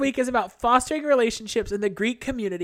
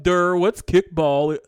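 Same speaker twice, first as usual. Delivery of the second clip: slow, dopey lowered "Durr" voice; dumb voice; 6 semitones lower, 5 dB louder, slower than usual. Retold or imitated. Mocking sisters acting stupid at sports, in a slow dopey voice. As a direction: play the clueless airhead. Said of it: "saying stuff like".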